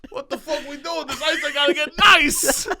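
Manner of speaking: high loud irritated rink worker; grumpy Zamboni guy. Acted. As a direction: grumble loudly like an annoyed ice driver. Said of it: "a guy on a Zamboni yelling".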